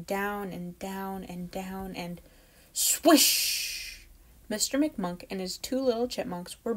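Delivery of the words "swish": breathy high whoosh of wind; a loud wind swish. A narrator's reading. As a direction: blow it out like a gust. Storm blows chipmunks out.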